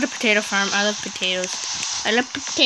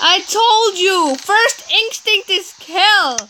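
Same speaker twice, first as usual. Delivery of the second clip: higher, slow, emphatic I-told-you; told-you exclaim; 7 semitones higher, 3 dB louder, much slower than usual. Real, not acted. Dad triumphantly telling daughter he was right, slow and high. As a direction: drag it out triumphantly.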